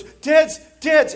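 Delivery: high, loud mock panic; high, urgent alarm; panicked kid brother. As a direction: cry out like a frightened little brother. Panicked brother warns, acted.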